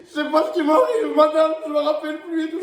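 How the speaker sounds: high, tearful fake confusion; fake crying. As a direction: sob out the act again. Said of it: "Je commence à chialer".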